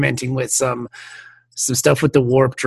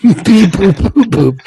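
louder mock computer beeps; robot beeps; 4 semitones higher, 6 dB louder, slower than usual. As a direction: chirp it like a machine. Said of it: "The message".

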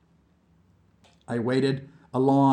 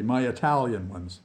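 plain reading voice

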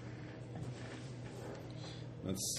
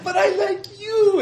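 high squeal, slow and laughing; squealing baby talk aunt; 11 dB louder, slower than usual. Acted. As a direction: coo it like a doting aunt. GM voices the imp pinching cheeks.

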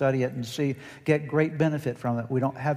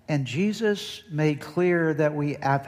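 ordinary delivery

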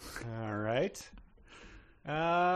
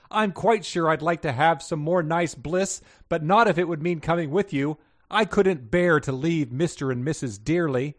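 higher, louder earnest character voice; earnest Missus; 6 semitones higher, 6 dB louder than usual. A narrator's reading. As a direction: protest politely in a raised voice. Voices female dog in story.

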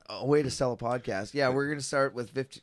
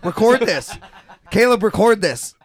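loud, laughing order to record; excited demand; 5 semitones higher, 9 dB louder than usual. Retold or imitated. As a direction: insist loudly, half laughing. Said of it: "start telling you to record it".

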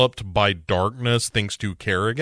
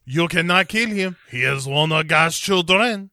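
put-on French accent; 6 semitones higher than usual. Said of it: "And Moreau says".

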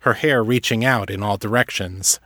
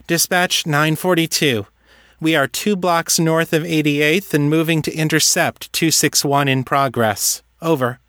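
higher, brisk cop on radio; radio call; 6 semitones higher than usual. A narrator's reading. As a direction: report in like a police officer. Kate calls on radio.

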